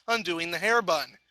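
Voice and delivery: plain reading voice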